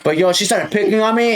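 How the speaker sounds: usual speaking voice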